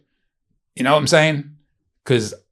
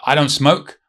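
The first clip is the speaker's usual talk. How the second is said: normal talking voice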